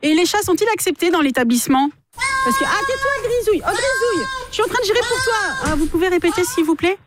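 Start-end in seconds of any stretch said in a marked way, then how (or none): 2.4-5.7 scolding the cat. Acted. Scolding the cat mid-call.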